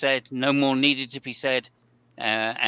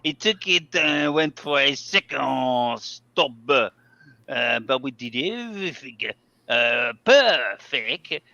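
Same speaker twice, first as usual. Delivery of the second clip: slow, hammed-up French accent reading a driver's quote; 4 dB louder, slower than usual. Reading the team quote aloud to co-hosts in a French accent. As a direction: put on your best French accent and take it slow. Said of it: "read it in your best French accent".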